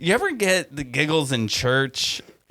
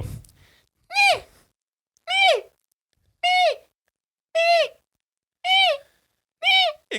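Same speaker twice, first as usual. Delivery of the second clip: mock sneezing; much slower than usual. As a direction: shriek each me like a sneeze building up. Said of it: "you hear the beast go".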